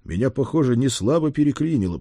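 ordinary delivery

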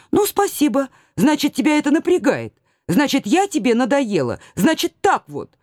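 high, huffy nagging wife; offended nagging. Acted. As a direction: take offence and nag him. Wife taking offence at husband.

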